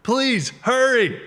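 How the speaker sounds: high, loud, desperate pleas, slow on please hurry; retold panicked cry, drawn out at the end; frantic pleading. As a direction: beg God to hurry up. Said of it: "Yes, yes".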